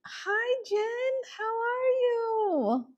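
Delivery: very high-pitched, drawn-out squeal; overexcited greeting. Real, not acted. Host asking Jen how she is, squealing with excitement. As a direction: coo it sky-high, stretching the last words. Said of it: "Sorry, I got too excited".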